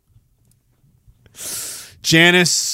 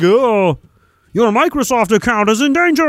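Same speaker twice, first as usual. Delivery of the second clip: mock scam voice; 6 semitones higher than usual. Reading spam email.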